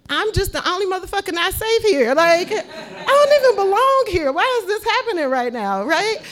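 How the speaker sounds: high, loud panicked rant. Retold or imitated. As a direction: panic rising in the voice. Retold panic, voice up.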